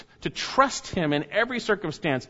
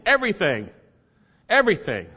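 ordinary talk, nothing marked

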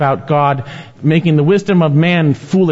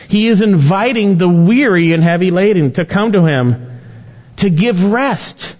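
high-pitched, insistent preaching; impassioned preaching; 7 semitones higher than usual. Real, not acted. Preacher urges the weary to come, impassioned. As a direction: preach it high, drawing out the last phrase.

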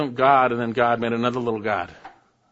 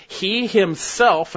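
He himself slow, higher and louder; emphatic; 8 semitones higher, 6 dB louder, much slower than usual. Acted. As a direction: hit He himself hard.

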